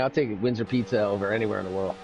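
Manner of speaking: ordinary talk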